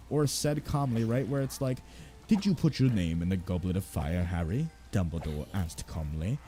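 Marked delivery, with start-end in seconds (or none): 2.3-6.4 put-on much lower, calm old wizard voice; calm Dumbledore. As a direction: read it like a serene old headmaster, deep and unhurried.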